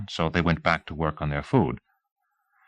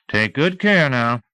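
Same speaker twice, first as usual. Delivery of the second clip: slow pointed warning; 6 semitones higher, slower than usual. A narrator's reading. Teasel's slow pointed farewell.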